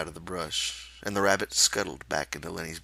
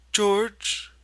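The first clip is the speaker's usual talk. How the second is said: put-on high, childlike confession; Lenny confessing; 7 dB louder than usual. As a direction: admit it like a guilty child. Lenny calling.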